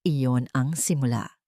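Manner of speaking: plain reading voice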